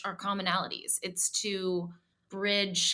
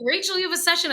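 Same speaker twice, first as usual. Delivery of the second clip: calling out; 10 semitones higher, 6 dB louder, faster than usual. Retold or imitated. Housekeeper calls upstairs to Rachel.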